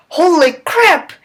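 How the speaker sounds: holy crap shot out high in disbelief; surprised exclamation. A narrator's reading. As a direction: jump up in pitch with sudden shock. Narrator's sudden astonished exclamation.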